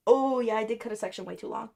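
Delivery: ordinary talk, nothing marked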